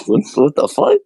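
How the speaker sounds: high, startled what the fuck; shocked. Retold or imitated. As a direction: act it out as a shocked outburst. Retold shocked reaction.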